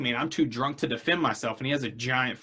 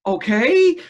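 much higher and louder, caught off guard; stunned okay; 12 semitones higher, 8 dB louder than usual. Retold or imitated. Stunned reply to the friend's confession, high and loud. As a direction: squeak out an astonished okay.

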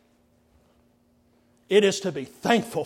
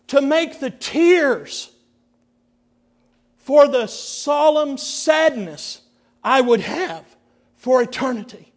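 higher, louder grieving, slowed; mournful lament; 8 semitones higher, 10 dB louder than usual. Retold or imitated. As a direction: ache through the words. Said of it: "He said".

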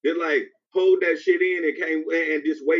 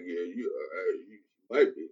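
meek mumble; 11 semitones lower, 7 dB quieter than usual. Retold or imitated. Voicing the meek man in song.

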